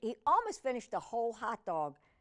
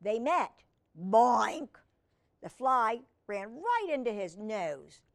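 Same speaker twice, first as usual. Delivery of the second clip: ordinary delivery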